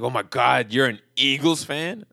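loud, drawn-out mock disbelief; mock appalled stranger. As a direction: imitate an outraged heckler, stretching the question. Mimics heckling stranger in story.

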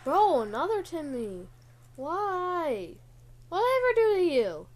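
high, loud, fast pleading whine; exasperated shout. Real, not acted. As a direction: yell at the game like it wronged you. Timmy appears again, exasperated.

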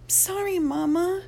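high, soft, tearful apology; a girl apologizing to her mother in tears. Acted. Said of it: "She brushes a tear from her cheek".

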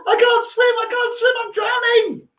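high hammed-up cries for help; mock panic. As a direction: fake drowning, shrieking very high. Pretends to drown in river.

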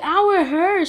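high, loud mock complaint; pained protest. Child complaining to mom about flat iron, pained protest. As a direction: reenact an indignant ouch to a parent.

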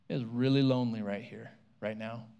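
low, soft, fading lament; lonely lament. Retold or imitated. Jesus alone after his friends abandon him, low and soft. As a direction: let the words sink away. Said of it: "Jesus is like".

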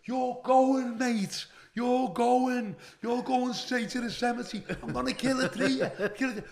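high-pitched ranting threats, retold with laughter breaking in; loud, high acted tirade; berserk threats. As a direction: rant like you've gone berserk. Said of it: "Went berserk, Billy".

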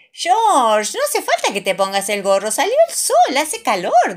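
high, loud cheerful call; Mummy Pig calling. A narrator's reading. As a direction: call George, the sun is out. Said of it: "dice mamá Pig".

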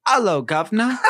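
mock English greeting with a laugh in it; English accent. Shown as a demonstration. Answering a friend's challenge, a jokey English accent. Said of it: "Can you do an English accent?".

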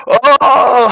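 a high shouted "Oh!" through laughter; suddenly spot a relative; laughing surprised oh. Acted. Player acts sudden recognition.

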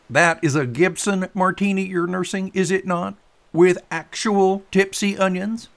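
loud, high, pointed questioning; needling boat buddy Tater. Acted. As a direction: tease him with big emphatic words.

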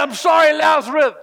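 high, loud pleading shout building to a command; Jesus raising Lazarus, loud and pleading; Jesus calling Lazarus. Acted. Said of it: "I can imagine".

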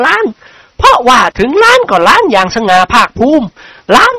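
high-pitched, placating lady's voice; sweet-talking noblewoman, pitched high; flattering lady character. Acted. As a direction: play a wife proudly buttering up her husband.

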